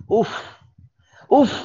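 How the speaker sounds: short, sharp 'uff'; exclaimed 'uff'. Shown as a demonstration. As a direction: puff out a quick 'uff'. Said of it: "इसके अलग माने हैं". (झटके से 'उफ')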